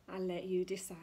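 ordinary talk

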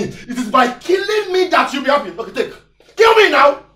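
bitter shout. Acted. Husband dares his wife to kill him, bitter shout. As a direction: dare her, shouting.

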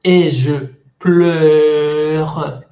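slow model phrase; pronunciation demo. Shown as a demonstration. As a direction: stretch each word of "Et je pleure". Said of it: "la misma pronunciación de E".

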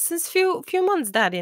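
lightly voiced innocent daughter; imitating little Hannah. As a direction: answer like a child who means well. Retelling daughter's innocent answer.

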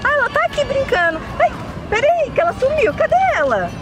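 high-pitched sudden worry; alarmed mother. Pretend. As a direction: break off the chat in acted alarm. Child vanishes during a phone call.

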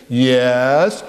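put-on drawn out for the kids to copy; slow leading chant; very slow demo. Shown as a demonstration. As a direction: stretch Jesus loves me out slowly. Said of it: "do it with me".

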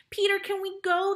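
high, loud excited plea; eager pleading. A narrator's reading. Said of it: "they pleaded".

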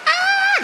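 very high, sustained shriek; mock scream. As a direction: scream like a horrified posh lady. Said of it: "Gritaba, weón". (grito agudísimo de señora espantada)

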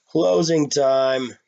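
slow, loud drawn-out call; shouted. A narrator's reading. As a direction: call it out across the bar, slowly. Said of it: "the bartender shouted".

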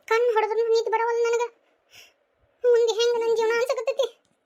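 high-pitched tearful lament; tearful despair. Acted. Sister pours out fear for her future to family, tearful despair. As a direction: despair aloud in a high, crying voice.

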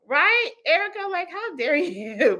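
high, playful outrage with a laugh; mock indignation. Wife teasing about her husband to Erica, high mock indignation.

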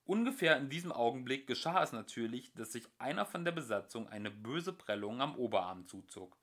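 plain reading voice

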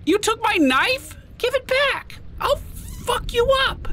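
high, shrill mock outrage; outraged high squeal. Real, not acted. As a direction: squeal at the thief. Creature steals his knife.

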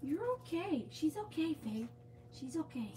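high, slow, soft reassurance; soothing coo. Real, not acted. Owner calming Saki and worried Faith, slow high coo. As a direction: stretch it out gently to calm the dogs.

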